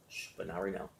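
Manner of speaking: soft, gentle hush to a passing thought; gentle shush. Shown as a demonstration. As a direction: shush your own thought kindly and softly. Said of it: "just gently say".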